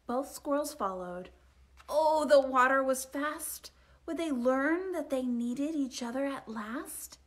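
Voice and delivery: ordinary delivery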